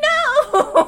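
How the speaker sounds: high, loud, drawn-out retold cry; dismayed wail. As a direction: wail it in mock anguish. Retold dismayed reaction to separation.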